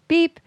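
high, loud electronic beep; mock phone beep, sharp and high; call waiting beep. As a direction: chirp it like a phone tone.

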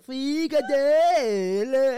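Frikadelle dragged out extremely slowly through laughter; very slow Frikadelle ending in a rise, laughing; stretched, laughing. Retold or imitated. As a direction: try to stretch "Frikadelle" while cracking up. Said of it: "du skal mere trække den frikadelle".

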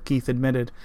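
ordinary delivery

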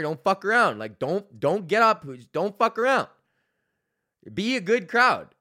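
loud scolding announcement; stern crowd warning. Retold or imitated. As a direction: lay down the law to the audience.

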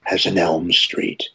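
low, slow menacing Freddy Krueger. Retold or imitated. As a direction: drop it low and slow, sinister. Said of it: "Freddy says".

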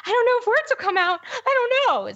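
high, fast, squeaky nervous voice; starstruck panic. Retold or imitated. To her husband about meeting Adam Schiff, high nervous squeak. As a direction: panic like a tongue-tied fan. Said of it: "I'm like".

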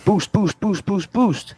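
rising, rapid-fire boost boost boost; excited button mashing. Retold or imitated. Telling a friend about mashing the boost button, excitedly.

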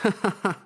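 short annoyed laugh, pitched high; a bitter laugh at the sergeant's joke; angry laugh. A narrator's reading. Ferdy laughs angrily at the police sergeant. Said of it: "Ferdy lachte ärgerlich".

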